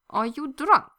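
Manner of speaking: loud suspicious question. A narrator's reading. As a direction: narrow your eyes and ask. Victor suspicious, leaning in.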